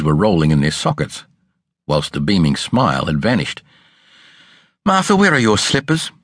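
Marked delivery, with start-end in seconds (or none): 4.9-6.1 stern, clipped question pitched high; a mistress scolding her maid; severe mistress. A narrator's reading. Mistress scolding Martha about her bare feet, severe. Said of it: "asked her mistress severely".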